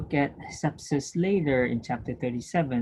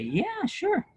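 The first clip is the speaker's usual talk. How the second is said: patient's reply. Pretend. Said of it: "I say".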